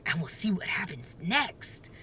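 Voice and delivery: hushed, breathy secret plan; whisper. Acted. Jack sharing a secret plan with his sister, whisper. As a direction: lean in and whisper it.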